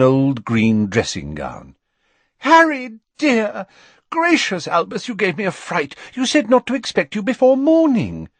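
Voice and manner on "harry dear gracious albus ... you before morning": high, flustered motherly voice; Mrs Weasley. A narrator's reading. Mrs Weasley welcomes Harry and Dumbledore late at night, high and flustered. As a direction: fuss over them, high and startled.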